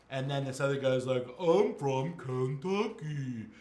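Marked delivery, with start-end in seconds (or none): 1.4-3.5 slow, drawn-out mocking echo; making fun. Jock makes fun of the teacher's hometown, mocking. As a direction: mimic him in an exaggerated dopey stretch. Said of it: "the jock guy who made fun of him".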